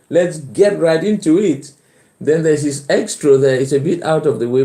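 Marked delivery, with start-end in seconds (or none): none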